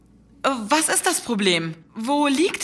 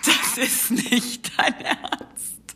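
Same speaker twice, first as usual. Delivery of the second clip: slow, breathy laugh through words; laughing disbelief; much slower than usual. Acted. Disbelief at the oracle find. (ungläubig lachend)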